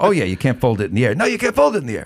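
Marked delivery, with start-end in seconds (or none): 1.2-2.1 much higher voice, mock Larry insisting; Larry impression. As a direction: pitch way up and argue the point. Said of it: "but he's like".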